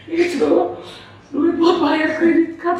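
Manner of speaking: loud, high weepy wail; acted tearful outburst, pitched far up; imitated crying. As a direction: drag it out through mock sobs. Acting the crying sibling.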